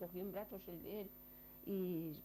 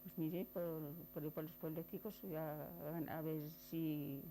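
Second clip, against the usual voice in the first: normal talking voice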